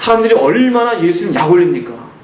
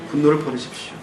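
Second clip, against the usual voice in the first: 분노를 said softer, falling; soft repeated exhortation; 4 semitones lower, 7 dB quieter than usual. Acted. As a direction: quietly press the word 분노를 home. (분노를 부드럽고 낮게 다시 강조)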